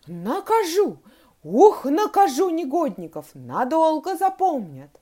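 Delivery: loud, high fuming threats; worked-up anger. A narrator's reading. Teacher unable to calm down, fuming loudly. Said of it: "она уже не могла успокоиться".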